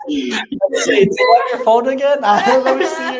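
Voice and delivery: teasing while cracking up; laughing tease. Real, not acted. Teasing co-host about the phone.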